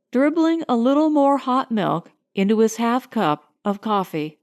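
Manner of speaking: ordinary delivery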